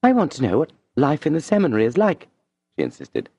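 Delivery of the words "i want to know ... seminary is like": higher woman's voice, gently insistent; Madame insisting. A narrator's reading. Madame insisting Julien tell his seminary life, gently. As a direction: press him to tell her.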